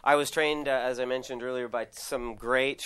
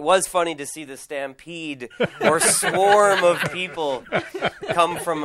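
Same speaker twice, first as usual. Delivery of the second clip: laughing; 6 semitones higher, 8 dB louder, slower than usual. Real, not acted. Chef laughs recounting staff stampede.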